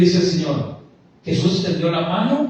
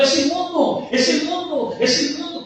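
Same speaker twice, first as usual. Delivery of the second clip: shouting warning; 11 semitones higher than usual. Retold or imitated. Herald walking ahead of the leper, shouting a warning. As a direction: cry it out to clear the road. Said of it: "iba adelante gritando".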